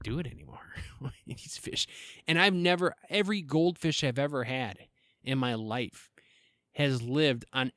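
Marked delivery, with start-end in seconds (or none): 0.0-1.9 unvoiced, breathy whisper; whispered exasperation. Real, not acted. Owner whispering about the fish that won't die, exasperated. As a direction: whisper it in despair.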